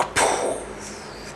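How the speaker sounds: soft, breathy blast noise; imitated explosion. The French firing nuclear weapons, imitated blast. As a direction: puff out an explosion sound.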